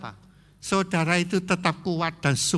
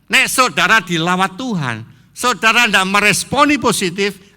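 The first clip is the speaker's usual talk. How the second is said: raised, higher sermon voice; forceful preaching; 5 semitones higher, 7 dB louder than usual. Real, not acted. Preacher urges congregation to respond to God's visitation, forceful preaching. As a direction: press the warning on the congregation loudly.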